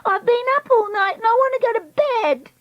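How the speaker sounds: high-pitched wail; overtired tantrum. Acted. Treacle up all night wanting bed, wailing. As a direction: whine way up high, worn out.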